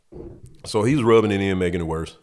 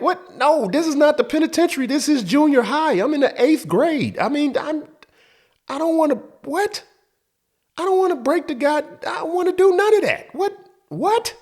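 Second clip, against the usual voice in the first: put-on very high, louder disbelieving kid voice; shocked kid; 4 dB louder than usual. Boy protesting penitentiary advice, very high shocked voice. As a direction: squeak out your outrage at the advice.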